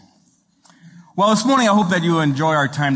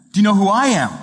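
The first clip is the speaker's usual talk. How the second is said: sly comeback; 6 semitones higher, 5 dB louder than usual. Retold or imitated. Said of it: "the usher said to the woman".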